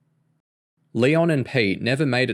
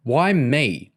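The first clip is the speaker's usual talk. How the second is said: indignant; 4 semitones higher, 3 dB louder than usual. A narrator's reading. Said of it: "Patricia said indignantly".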